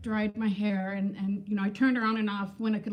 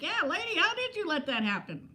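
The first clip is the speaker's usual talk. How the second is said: much higher, mocking jab at her; scornful answer to the woman on TV; sarcastic retort; 8 semitones higher than usual. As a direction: taunt her with a high sarcastic voice. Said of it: "And I'm like".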